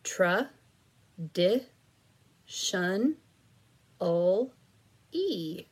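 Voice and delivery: drawn out syllable by syllable, very slow; split into syllables. Shown as a demonstration. Teacher sounds out syllables for students to blend, very slow. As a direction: stretch every syllable apart for the child. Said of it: "here's our next one".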